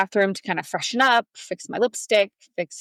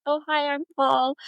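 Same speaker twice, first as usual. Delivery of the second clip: higher-pitched mock nervous introduction; 7 semitones higher than usual. Boyfriend introduces himself to her daughter, mock nervous. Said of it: "He's so nervous".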